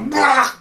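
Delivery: high loud comic bah; mock bonk cry. GM voicing the goblin hit on the head, a high loud bah. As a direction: blurt it like a goblin getting clubbed. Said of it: "and go".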